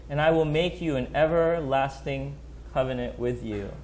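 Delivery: scripture reading. Acted. Reads Isaiah aloud.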